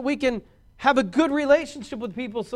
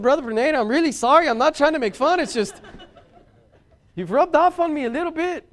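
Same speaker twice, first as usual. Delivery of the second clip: put-on Mexican accent; 6 semitones higher, 5 dB louder than usual. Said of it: "I was talking like this".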